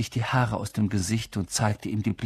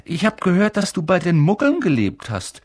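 put-on curious boy; 9 semitones higher, 6 dB louder than usual. Ron curious about Harry.

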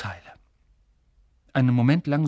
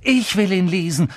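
loud, raised angry demand; furious; 5 semitones higher, 5 dB louder than usual. A narrator's reading. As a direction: insist on it angrily. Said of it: "sagte Harry wütend".

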